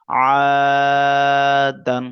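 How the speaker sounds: আদান with the final আ stretched very long and loud; long drawn vowel. Shown as a demonstration. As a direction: hold the আ in আদান a long time. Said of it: "এখানটা এরকম পড়তে হবে". (আদান শব্দে আ লম্বা টেনে পড়া)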